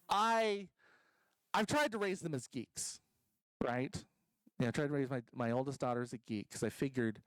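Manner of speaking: ordinary talk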